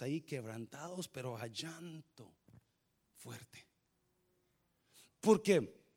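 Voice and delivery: soft, low, intimate preaching that fades to near silence; tender low preacher's voice; hushed sermon. Acted. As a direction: draw the church in close and quiet. Soft intimate testimony, weeping topic.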